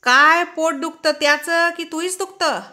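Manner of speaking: high, loud mocking yell; sneering shout. A narrator's reading. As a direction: snarl it back at her with contempt. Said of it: "फनकारात ओरडला".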